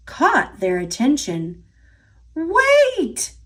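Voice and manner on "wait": sharp high shout of wait. A narrator's reading. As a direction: call out to stop her. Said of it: "the hungry farmer shouted".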